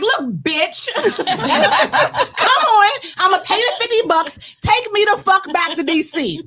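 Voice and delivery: loud, fed-up demand; fed-up yell. Retold or imitated. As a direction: bark it like you've had enough. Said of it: "I was like".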